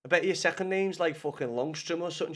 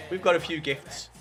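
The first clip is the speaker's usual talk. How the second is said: ordinary delivery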